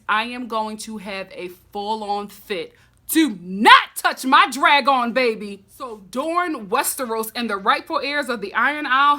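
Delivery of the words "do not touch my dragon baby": loud outburst. Real, not acted. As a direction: say it like a threat to anyone near the dragons. Protective outburst over dragons.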